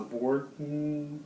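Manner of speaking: normal talking voice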